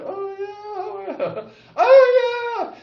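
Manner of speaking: very high, drawn-out comic voice; mock defiant retort. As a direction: stretch it out high, like a cartoonish comeback. Mock retort to being banned.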